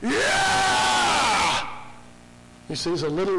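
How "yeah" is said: big high roaring shout; lion roar. Acted. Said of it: "a lion on the throne going".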